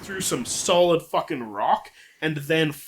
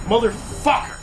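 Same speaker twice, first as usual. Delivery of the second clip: higher, scolding reaper voice; stern Grim Reaper; 7 semitones higher than usual. Acted. Grim Reaper scolding the immortal man for dying. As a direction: tell him off like an annoyed reaper.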